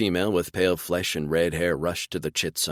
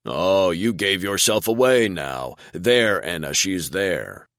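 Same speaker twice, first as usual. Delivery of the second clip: slow, louder and teasing, a hint of a deep voice; amused deep rumble; 2 semitones higher, 5 dB louder, slower than usual. A narrator's reading. Father finding his giggling child in hide-and-seek, amused. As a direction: catch her out with a chuckle in the voice. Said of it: "there was amusement in his voice".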